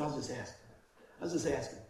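soft, low, innocent shrug of a line; mock innocent. Preacher teasing the stumped skeptic, mock innocent and soft. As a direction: play it coy and harmless.

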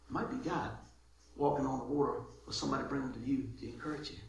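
ordinary talk

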